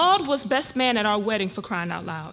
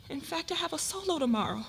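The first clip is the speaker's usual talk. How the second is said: ordinary delivery, nothing marked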